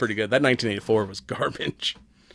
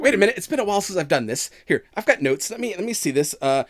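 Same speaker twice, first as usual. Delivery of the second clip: lightly voiced Valley Girl goddess; flustered, bubbly Valley Girl hostess; 7 semitones higher than usual. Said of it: "She's like".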